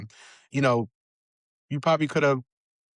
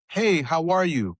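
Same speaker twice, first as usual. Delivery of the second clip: breezy greeting; 5 semitones higher, slower than usual. Retold or imitated. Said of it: "we'll say things like".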